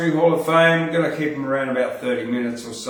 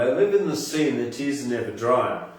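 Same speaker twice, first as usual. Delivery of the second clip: louder, lively spoken-word rhythm; poem recital; 3 semitones higher, 4 dB louder than usual. Acted. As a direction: perform it to the crowd with energy.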